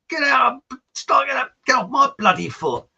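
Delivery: high, loud mock-furious outburst; angry shout. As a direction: yell it like a trodden-on partner. Dance partner shouting, mistaken for song title.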